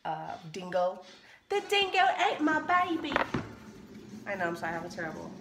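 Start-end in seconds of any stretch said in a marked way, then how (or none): 1.5-3.1 hammed-up, pitched-up Australian accent quote; a bad, jokey Australian accent impression; mock Australian accent. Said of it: "I can't even do Australian accents".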